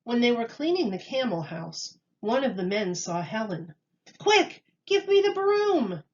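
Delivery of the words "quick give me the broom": high, loud, urgent yell; shout. A narrator's reading. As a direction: yell for the broom in a panic. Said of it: "he shouted".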